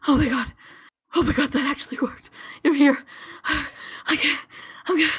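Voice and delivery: high, winded, overwhelmed gasping; out of breath. Acted. Mikhail falls beside revived Leto, breathlessly overwhelmed. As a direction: pant through the shock after sprinting. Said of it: "so fast and so sloppily".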